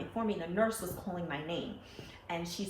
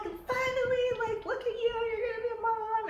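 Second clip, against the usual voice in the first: excited screaming; 5 dB louder than usual. Retold or imitated. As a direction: squeal it like a thrilled best friend. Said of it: "she's screaming, and she's crying".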